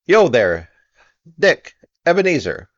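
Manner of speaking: loud, high hearty call; jovial call. A narrator's reading. Fezziwig calling his apprentices, jovial shout. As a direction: call out like a jolly old master. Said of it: "comfortable, oily, rich, fat, jovial voice".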